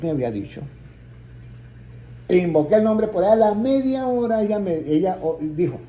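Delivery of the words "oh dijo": ordinary delivery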